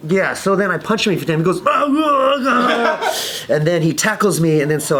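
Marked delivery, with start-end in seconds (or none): 1.7-3.3 high mock yelps; comic cries of a man being hit; imitated pained cries. Aggressor getting punched a few times, mock cries.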